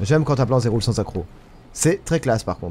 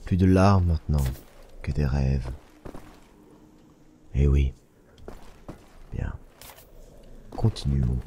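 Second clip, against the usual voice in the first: deep hero voice; 11 semitones lower, 5 dB quieter than usual. Pretend. Dramatic hero voice in gameplay.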